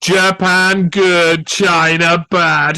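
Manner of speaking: slow, loud, blunt slogan; mock caveman slogan. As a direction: spell it out slowly like a dumb slogan. Mocking simplistic message.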